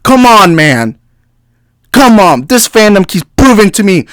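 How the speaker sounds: fed-up come on; exasperated. Real, not acted. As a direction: throw up your hands. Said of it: "I'm sorry if I'm yelling".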